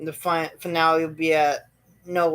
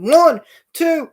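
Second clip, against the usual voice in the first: excited pin count; 12 semitones higher, 9 dB louder, slower than usual. Retold or imitated. Referee count, surprise pin.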